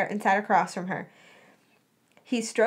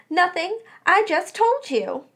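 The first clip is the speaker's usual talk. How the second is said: defensive; 8 semitones higher than usual. A narrator's reading. Defensive friend being questioned.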